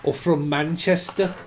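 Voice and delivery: ordinary talk, nothing marked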